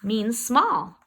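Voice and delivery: ordinary delivery, nothing marked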